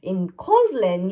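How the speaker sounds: usual speaking voice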